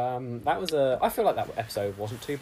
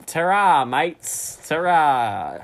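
hammed-up bad Australian accent; Mister Aussie; 6 dB louder, much slower than usual. Mister Aussie signing off from the show, overdone Australian accent. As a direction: lay the Aussie slang on thick. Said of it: "bad impression of an Australian person called Mister Aussie".

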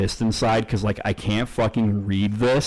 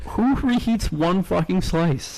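much higher, slowed, disbelieving question; incredulous; 9 semitones higher than usual. Real, not acted. Reacting to the co-host's pizza tip, high incredulous disbelief. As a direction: scoff at the idea.

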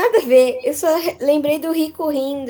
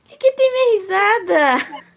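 laughing; 7 semitones higher, 4 dB louder than usual. Real, not acted. Laughing about her laugh.

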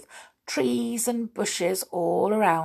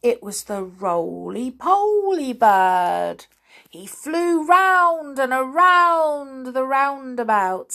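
ordinary delivery

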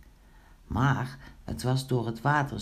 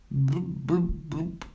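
short spluttering blubs; bubbling noises; slower than usual. A narrator's reading. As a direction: imitate water bubbling out of a mouth. Imitates Pinkeltje spitting water. (proestende borrelgeluidjes)

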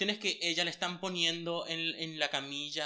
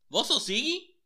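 high, incredulous "¿Vos sos Siggy?"; surprised question; 11 semitones higher, 4 dB louder than usual. Retold or imitated. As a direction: ask it high in disbelief. Personajes sorprendidos preguntan. (pregunta incrédula, aguda)

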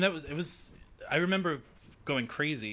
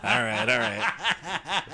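slow, high laughing words; 8 semitones higher, much slower than usual. Real, not acted.

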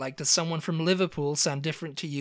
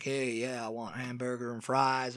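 casual American customer, low and soft; imitated American accent; 5 semitones lower, 5 dB quieter than usual. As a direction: toss off an American's order. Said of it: "an American came in and was like".

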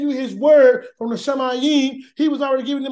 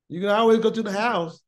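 usual speaking voice

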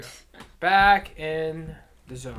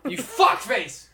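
mock insult; 6 semitones higher, 6 dB louder, slower than usual.

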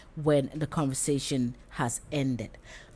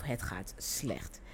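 softer, slow, downcast; sad reply; 4 semitones lower, 9 dB quieter, much slower than usual. Shown as a demonstration. Someone not doing well answers softly and sadly. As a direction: let it sink, slow and soft.

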